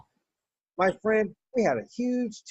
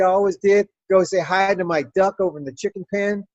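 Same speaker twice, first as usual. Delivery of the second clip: ordinary talk, nothing marked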